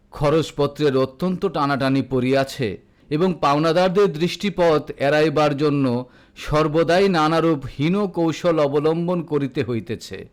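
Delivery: ordinary delivery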